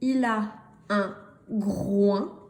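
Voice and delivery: slow, word by word with clear pauses; slow reading model. Shown as a demonstration. Teacher reading aloud for beginning readers, slow and measured. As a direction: separate each word, lean on the key ones.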